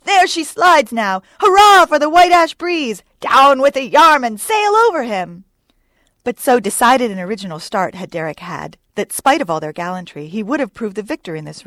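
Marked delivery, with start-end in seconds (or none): 0.0-5.5 high, loud cheering shout; drawn-out triumphant hurrahs; exhilarating cry. A narrator's reading. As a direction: whoop the oarsmen on. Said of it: "with an exhilarating cry of".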